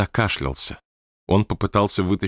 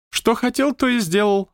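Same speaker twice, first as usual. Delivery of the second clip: lightly raised, stately manager; high manager voice. Acted. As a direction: speak as the odd high-voiced boss. Manager answers. (высокий голос руководителя)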